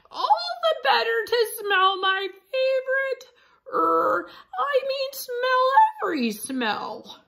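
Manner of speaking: high, loud granny-wolf voice; wolf as grandma. Acted. Wolf pretending to be grandma to Red, high put-on voice. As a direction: squeak it out like a wolf faking a grandma.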